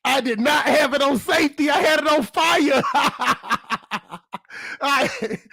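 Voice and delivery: high, amazed disbelief; shocked laugh. Real, not acted. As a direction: realize it with nervous laughter. Discovers gun wasn't on safety.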